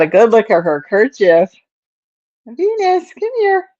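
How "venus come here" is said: very high, light call of her name; high pet call. Real, not acted. Owner calls her cat Venus to come. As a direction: call the cat like a tiny thing.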